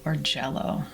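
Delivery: calm, measured meditation voice; guided relaxation. Acted. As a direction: guide listeners gently through releasing tension, with long pauses. Leads whole body relaxation.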